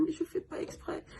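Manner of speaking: soft, breathy sob of a plea; tearful plea to the nurse, barely voiced; sobbing. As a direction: whisper it through tears. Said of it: "je fondais en larmes".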